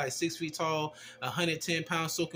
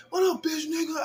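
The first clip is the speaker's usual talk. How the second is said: high hammed-up threat; mock tough guy; 11 semitones higher than usual. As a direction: imitate the punk trying to sound hard. Voicing the angry rider.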